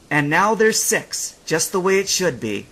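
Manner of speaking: put-on proud boy. A narrator's reading. Boy satisfied.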